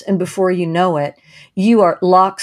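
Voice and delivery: ordinary talk, nothing marked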